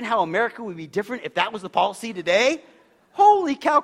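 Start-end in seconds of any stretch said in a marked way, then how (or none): 3.1-3.8 sudden exclaim, much higher and louder; holy cow. Real, not acted. Preacher marvelling at credit card companies, loud surprise. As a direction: burst out in amazement. Preacher imagines no bankruptcy policy.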